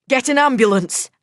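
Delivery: high, loud call for help; shouting to the young men for help. A narrator's reading. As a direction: shout it toward the door. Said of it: "I shouted".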